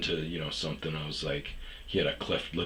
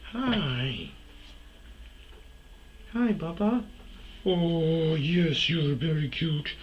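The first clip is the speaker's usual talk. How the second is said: put-on much higher, cooing baby-talk voice; cooing sweet voice; 9 semitones higher than usual. Imitating a guy cooing to someone, in a much higher, sweet voice. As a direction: coo it like greeting a pet. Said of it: "this dude hit me up and was like".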